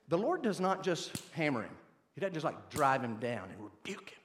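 ordinary talk, nothing marked

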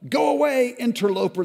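high, loud, sharp command; loud demand. Acted. Savages shouting at the firstborn to go away. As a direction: order the stranger away. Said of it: "they demanded".

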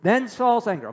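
usual speaking voice

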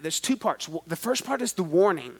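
usual speaking voice

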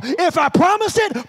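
high, loud preacher's declaration; forceful promise. Acted. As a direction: proclaim it as God's pledge. Acts God's promise, sermon building.

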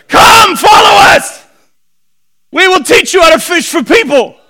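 loud, high holler across a lake; over-the-top bellowed recruiting call. Acted. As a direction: shout the invitation to a distant boat. Imagined shouting to boaters at lake.

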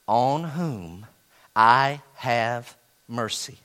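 each word of the verse drawn out slowly; slow down and let every word land; read slowly. Shown as a demonstration. Preacher reading God's words to the congregation, slow and weighty. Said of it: "I'm gonna read it slow".

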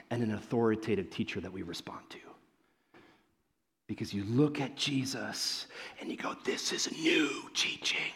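soft, whispered intensity; whispered emphasis. Real, not acted. Preacher stresses Jesus's authority to the congregation in a whisper. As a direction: lean in and whisper the point.

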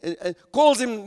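ordinary talk, nothing marked

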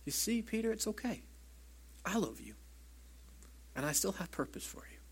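soft, high reassurance to a friend who failed; tender reassurance. Acted. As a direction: comfort him gently, barely above a breath.

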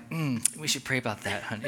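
husband playing pious to his wife; pious sweet talk. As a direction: put on a saintly voice. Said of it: "I will always be like".

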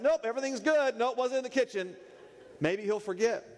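high-pitched voice of a guilty dog; dog feigning innocence. Acted. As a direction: act breezily innocent, pitched well up. Dog feigning innocence after being caught.

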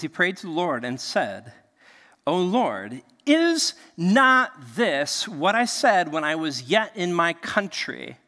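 slow, deliberate Bible reading; scripture reading. Acted. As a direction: read the prophet's prayer with weight. Reading Jonah's prayer aloud.

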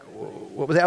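confused listener. Retold or imitated. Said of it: "You're like".

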